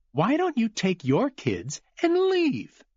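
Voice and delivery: high, slow and cutting; sneering Glenn. Acted. Glenn telling Cooper to take his kids and leave, high sneer. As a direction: dismiss him with contempt.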